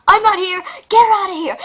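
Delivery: high, loud laughing yell; panicked shout. Acted. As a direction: shout to get rid of the cat while cracking up. Russell panics, wants cat gone.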